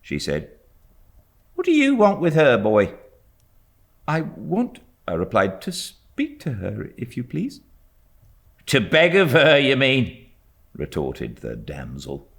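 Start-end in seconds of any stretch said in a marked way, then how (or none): 1.5-2.9 high, loud, fast challenge; suspicious maid. A narrator's reading. Maid challenging the ragged boy, loud and high. 4.0-7.6 put-on timid boy's voice. A narrator's reading. Boy answers the maid. 8.7-10.2 high, loud, sneering retort; scornful maid. A narrator's reading. Maid retorting to the boy, loud and high with scorn.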